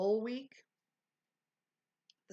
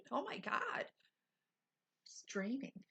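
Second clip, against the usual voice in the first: usual speaking voice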